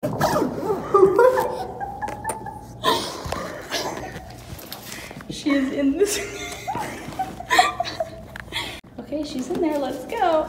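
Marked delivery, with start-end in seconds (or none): 0.2-5.2 laughing fit. Real, not acted. Friend stuck in a box. 6.2-8.9 laughing fit. Real, not acted. Friend stuck in a box.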